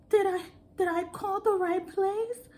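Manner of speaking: high, hesitant mock-shaky question; shaky caller. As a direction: imitate the nervous customer doubting herself. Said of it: "her voice sounded so shaky".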